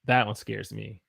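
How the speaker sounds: ordinary talk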